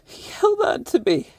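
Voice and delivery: high, cracking through tears; overwhelmed voice breaking high; choked-up joy. Real, not acted. Tearful grief over lost cat.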